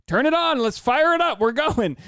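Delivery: high, loud rallying cry; excited. Retold or imitated. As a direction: fire everyone up to get going. Imitating her own eager podcast start.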